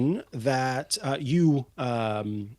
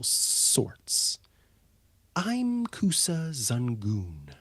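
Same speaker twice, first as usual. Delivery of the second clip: composed kobold matriarch; 3 dB quieter than usual. Acted. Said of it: "Kusa looks up at you and she says".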